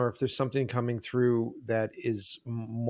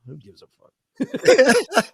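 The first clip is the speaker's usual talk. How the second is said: much higher, louder and slow, through laughter; laughing shout; 12 semitones higher, 10 dB louder, much slower than usual. Real, not acted. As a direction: toss it off with a big laugh. Laughing dismissal of meaning.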